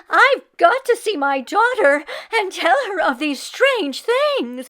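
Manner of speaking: put-on high, loud, out-of-breath old woman; high, loud panting old woman running. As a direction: gasp it out while running through the village. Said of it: "panted Meshka".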